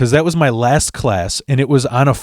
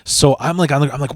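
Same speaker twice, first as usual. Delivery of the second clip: plain reading voice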